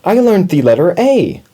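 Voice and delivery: high, loud excited little mouse. A narrator's reading. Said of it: "Little Mouse was very excited".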